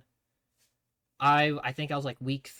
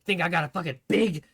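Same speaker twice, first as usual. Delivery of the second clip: higher, louder yelling tirade; mock drill sergeant rage; 8 semitones higher, 8 dB louder than usual. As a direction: yell mockingly about clown looks. Drill sergeant berating smiling kid.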